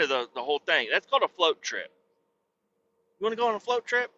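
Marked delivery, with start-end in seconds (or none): 3.2-4.1 higher, rising invitation; hyped-up sales question; teasing question. Shown as a demonstration. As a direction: dangle the offer like a tempting pitch. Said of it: "Fuck yes".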